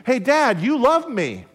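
put-on a child's happy outburst to her dad; excited little girl. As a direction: daughter gleefully telling her dad he loves her. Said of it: "said excitedly".

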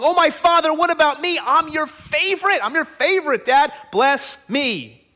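high-pitched, insistent begging of a father; acted anguished outburst, pitch way up; desperate crying plea. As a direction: plead like the passed-over favorite son. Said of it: "And so he screamed".

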